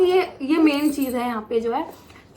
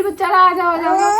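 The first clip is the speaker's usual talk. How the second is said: higher, louder hurry-up call; loud hurried nurse call; urgent urging; 5 semitones higher, 8 dB louder than usual. Retold or imitated. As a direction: nurse rushing the patient. Nurse urging her along.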